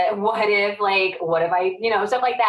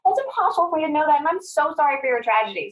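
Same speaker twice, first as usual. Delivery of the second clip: mock exasperated self; 9 semitones higher than usual. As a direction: squeak it out, exasperated and defensive. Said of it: "I'm like".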